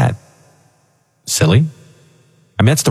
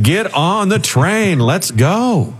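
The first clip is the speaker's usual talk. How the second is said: devil voice; 9 semitones higher, slower than usual. Retold or imitated. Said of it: "He's like".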